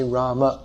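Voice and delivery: Rama with long first a and short final a; proper Sanskrit Rama. Shown as a demonstration. Teacher showing Sanskrit pronunciation, Rama with final vowel. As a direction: sound both syllables of Rama, ending on the vowel. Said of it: "to say the name properly according to Sanskrit pronunciation".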